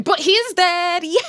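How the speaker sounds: high, loud jubilant burst; gleeful announcement. Real, not acted. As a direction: crow it with delight. Celebrating a character's death.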